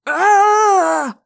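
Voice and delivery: sharp high cry of pain. A narrator's reading. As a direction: cry out clutching your head. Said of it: "the teacher cried".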